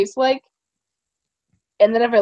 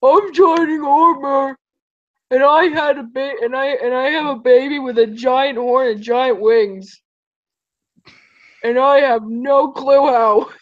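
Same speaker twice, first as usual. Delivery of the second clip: Shining Armor voice. Pretend. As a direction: play the proud prince, slowly. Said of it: "You sound like Shining Armor".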